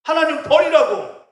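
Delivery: fiery preacher's shout; rising sermon shout. Real, not acted. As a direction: press it home with force. Preacher building to a climax.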